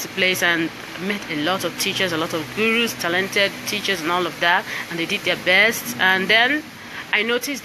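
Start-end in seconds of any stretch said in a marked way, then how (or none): none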